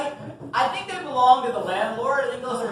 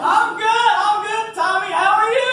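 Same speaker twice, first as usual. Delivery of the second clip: excited greeting; 10 semitones higher, 5 dB louder than usual. Acted. Excited high greeting back.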